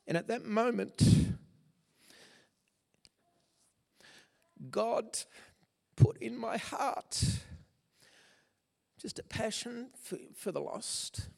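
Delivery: fighting back tears while recalling the conversions; choked up. Real, not acted. Preacher recalling his first altar call, voice breaking with emotion. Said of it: "Sorry, I don't know why I'm so emotional".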